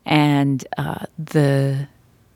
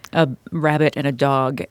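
ordinary talk

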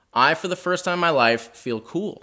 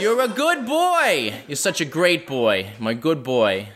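mom impression; slower than usual. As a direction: stretch out the praise like a doting Boston mother. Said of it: "This is a spot-on impression of my mother".

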